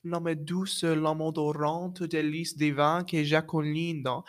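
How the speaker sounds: measured French recitation with Italian-like vowels; Italian-sounding French. Shown as a demonstration. Voice student reciting an art song to a doubter, Italian-sounding French accent. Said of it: "You're saying it with an Italian accent".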